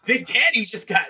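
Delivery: hammed-up ring announcer voice; mock announcer. To the listeners after being cut off, mock announcer. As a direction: announce it like a wrestling emcee, in jest.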